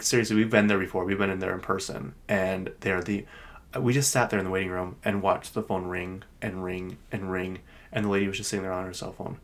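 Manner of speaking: normal talking voice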